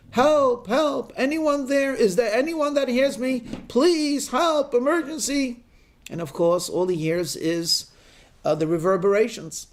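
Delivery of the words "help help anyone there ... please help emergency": desperate shout for help. Acted. Hiker clinging to a bush shouting for help, panicked yell. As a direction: yell for rescue hanging off a cliff. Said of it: "So he shouts".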